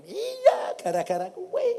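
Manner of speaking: very high, loud comeback; hammed-up high outburst; exclaimed retort. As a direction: squeal the blame back, played for laughs. Acted retort to surprised mother.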